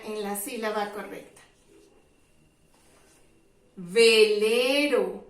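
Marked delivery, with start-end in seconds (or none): 3.8-5.2 Velero drawn out slowly; slow model word. Shown as a demonstration. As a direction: stretch Velero so children hear the V. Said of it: "Tiene la sílaba V".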